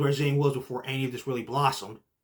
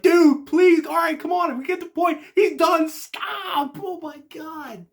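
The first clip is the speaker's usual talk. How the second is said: much higher, loud begging cries to stop; desperate pleading; 14 semitones higher, 6 dB louder, slower than usual. Retold or imitated. Fan begging the wrestler to stop the chair shots, high and loud. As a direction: yell at the screen to make it stop.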